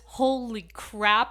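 loud, slow startled exclaim. Retold or imitated. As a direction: gasp it out in shock. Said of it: "this fear".

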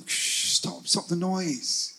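soft, slowed hushing; mock shushing. People silencing a born singer, mock shushing. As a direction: hiss a shh, then softly draw out the order. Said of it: "and then say".

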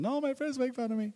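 put-on high pleading child protest; whiny kid voice. Twelve-year-old boy whining to his mom. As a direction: whine like a kid begging to quit.

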